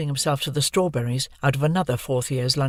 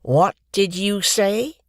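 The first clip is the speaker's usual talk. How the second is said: threatening bully; 5 semitones higher, slower than usual. Acted. Bully turning on her.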